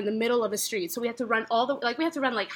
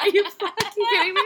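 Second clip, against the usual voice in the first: incredulous; 8 semitones higher than usual. Retold or imitated. Outraged at friend's easy exit.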